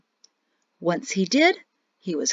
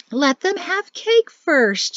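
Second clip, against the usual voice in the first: high, slow, coaxing mother-in-law voice; 8 semitones higher, slower than usual. Acted. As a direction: coax him sweetly, drawing it out.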